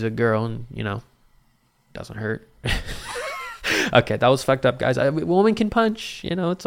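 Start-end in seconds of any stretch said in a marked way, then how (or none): 2.6-3.9 high, soft, breathy laugh; real voiceless giggle; wheezy laugh. Real, not acted. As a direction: laugh airily at your own joke. Laughs at own off-colour joke.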